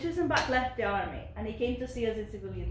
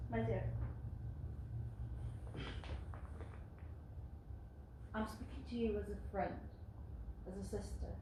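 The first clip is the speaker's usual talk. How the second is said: much softer, close and intimate; gentle confiding; 3 semitones lower, 13 dB quieter than usual. Acted. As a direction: lean in and confide. Sister speaking gently as friend.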